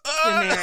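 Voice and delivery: high, loud mock yell; mock warm-up yell. Co-host teasing the accent attempt, high mock yell. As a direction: imitate someone forcing an accent out. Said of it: "you have to get it out".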